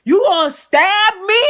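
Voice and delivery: high, loud imitation of outrage; incredulous yell. Imitating the guest facing a knife, loudly incredulous. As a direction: shoot the question up in shocked disbelief.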